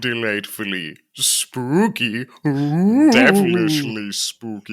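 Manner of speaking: slow, eerie spooky drawl. Pretend. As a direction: play it ghostly and eerie. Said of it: "We're gonna get spooky voice".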